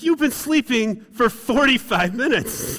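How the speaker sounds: high, loud mock scolding; hammed-up indignant accusation; exasperated wife. Acted wife scolding husband.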